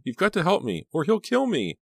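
very high, panicked pleading; frantic high-pitched appeal; desperate cry. A narrator's reading. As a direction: beg as if your life depends on it. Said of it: "she cried".

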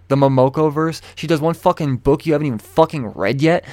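loud, fast, exasperated rant. Real, not acted. Vlogger ranting at viewers about Momoko hype, loud angry rant. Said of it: "I'm on a goddamn rant".

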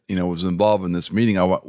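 ordinary talk

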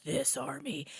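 soft, high, menacing reply; ominous reveal. Retold or imitated. Aragorn answering the pirates' taunt, soft and ominous. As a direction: let it land quietly, ominous.

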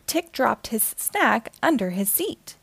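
plain reading voice

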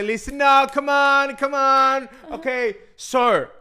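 loud, high barked orders; imitating security officer. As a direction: shout commands at the passengers like a guard. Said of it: "el policía que está allí dice". (imitando al policía del aeropuerto a gritos)

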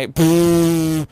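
loud burst of laughter; real nervous laugh; embarrassed laugh. Real, not acted. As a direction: laugh at your own blunder. Laughs at own slip.

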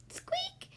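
very high, soft squeak; mouse squeak. Acted. Mouse squeaks at lion, tiny and high.

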